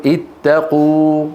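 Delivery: اتقوا stretched slowly with the ta clear; slowed word. Shown as a demonstration. Teacher models the imperative for pupils, slowly. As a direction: draw out اتقوا slowly and evenly. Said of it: "إذا نقول".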